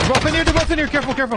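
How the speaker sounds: alarmed yell. Real, not acted. Alarmed callout, enemies in room.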